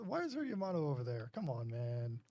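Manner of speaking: ordinary talk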